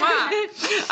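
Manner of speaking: loud high kiss sound; blown kisses. Real, not acted. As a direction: smack a big kiss into the mic. Playful goodbye kisses between hosts.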